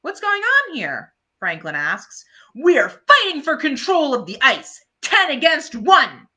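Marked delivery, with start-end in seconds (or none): 0.0-1.1 put-on much higher, curious boy's voice; Franklin asking. Franklin arriving on ice. 2.5-6.3 louder, higher defiant shout; rally against the odds; Patty shouting. Acted. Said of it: "Peppermint Patty shouts".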